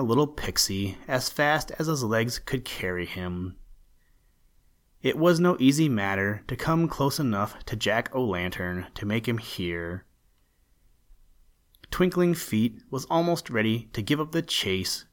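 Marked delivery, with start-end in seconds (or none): none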